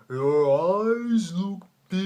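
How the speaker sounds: drawn-out slow-mo, loud and high; slow-motion voice. Retold or imitated. Friend up close as slow-mo starts, slow-motion voice. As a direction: stretch every word out like slowed-down film. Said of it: "a slow-mo starts".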